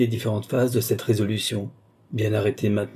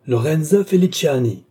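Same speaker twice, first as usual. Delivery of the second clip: high, raised summons by name; commanding cry; 7 semitones higher, 5 dB louder than usual. A narrator's reading. Count summoning Lorenza over the princess's will, commanding cry. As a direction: call out to dominate everything. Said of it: "cria le comte, dominant tout".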